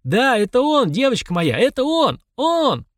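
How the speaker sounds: high, exultant calling out; elated exclamation rising to the last word; joyful cry. A narrator's reading. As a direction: cry it out overjoyed, pitch climbing. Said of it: "смеясь, крикнул ей".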